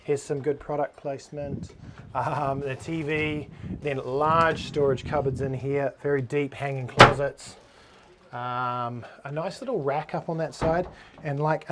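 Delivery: normal talking voice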